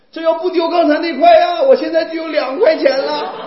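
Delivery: high, loud imitation of a wailing child; mock bawling. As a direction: bawl the complaint as a hammed-up kid. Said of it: "哭得更惨了". (模仿孩子大声哭诉)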